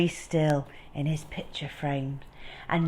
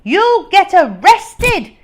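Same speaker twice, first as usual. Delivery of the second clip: gloating shout; 10 semitones higher, 14 dB louder, slower than usual. Acted. Mister Dart gloating shout.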